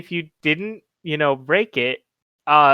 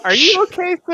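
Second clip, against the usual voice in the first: affected polite newcomer; 13 semitones higher, 5 dB louder, faster than usual. Acted. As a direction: politely question him, pitch climbing with concern. Plays the would-be smart character.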